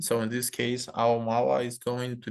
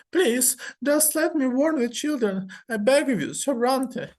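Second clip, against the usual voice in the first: much higher, louder begging, dragged out; terrified plea; 12 semitones higher, 3 dB louder than usual. Acted. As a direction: scream for mercy in terror. Mother begs to warn children.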